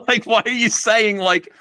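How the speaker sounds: high, loud, laughing disbelief; incredulous laughter. Retold or imitated. Recounting a baffling message to co-host, laughing incredulously. Exasperated retelling of baffling reply.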